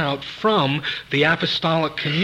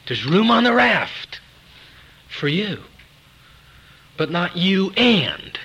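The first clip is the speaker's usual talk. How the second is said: high, ringing pulpit appeal with pauses; emphatic preaching; 5 semitones higher, 5 dB louder, slower than usual. Real, not acted. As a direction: drive it home to the congregation, phrase by phrase. Preacher drives home the image.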